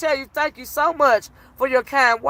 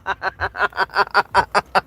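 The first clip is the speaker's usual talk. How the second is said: long, low rapid-fire chuckle; helpless laugh; 6 semitones lower than usual. Real, not acted. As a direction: laugh on and on in even bursts. Breaks up at the memory.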